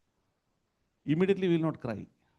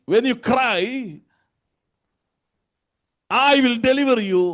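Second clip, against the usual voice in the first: raised, ringing sermon delivery; full-force preaching; 10 semitones higher, 7 dB louder than usual. Acted. Preacher quoting the Lord's promise to the congregation, forceful preaching. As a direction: preach it out loud and high.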